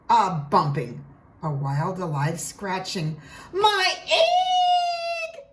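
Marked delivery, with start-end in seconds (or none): 3.5-5.4 excited shout. A narrator's reading. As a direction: cry out in amazement. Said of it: "shouted Horton".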